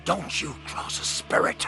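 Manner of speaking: hushed, frightened warning; soft, superstitious dread; fearful whisper. Acted. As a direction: whisper it, scared of the ghost.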